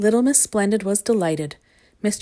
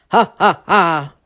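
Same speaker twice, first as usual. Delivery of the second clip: loud booming dragon guffaw; big, acted monster laughter; roaring laugh; 9 dB louder, slower than usual. A narrator's reading. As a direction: roar out each laugh separately. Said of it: "roared the dragon".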